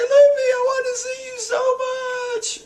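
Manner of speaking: very high-pitched wail; hammed-up tearful cry; mock crying. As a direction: bawl it out like an overcome cartoon character. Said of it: "poor Brook is crying".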